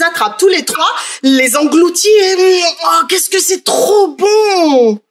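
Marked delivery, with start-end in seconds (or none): 2.6-5.0 delighted savouring. A narrator's reading. Pietrino savouring the gnocchi.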